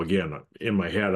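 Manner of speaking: ordinary talk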